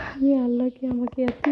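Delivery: ordinary talk, nothing marked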